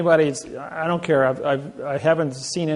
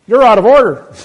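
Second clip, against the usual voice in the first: booming, much higher and louder "You're out of order"; judge's rebuke; 8 semitones higher, 17 dB louder than usual. Retold or imitated. The judge silencing the attorney, a booming high shout. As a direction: let the judge bring down the hammer.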